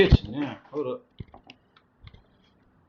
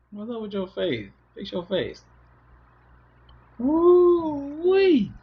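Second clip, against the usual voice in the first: put-on high playful baby talk to a little one; 14 semitones higher, 6 dB louder than usual. Dad teasing his baby girl about her face, high baby talk. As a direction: tease the baby gently, sing-song.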